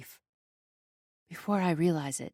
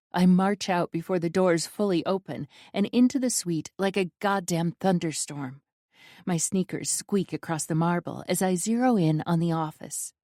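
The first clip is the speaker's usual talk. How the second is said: ordinary delivery, nothing marked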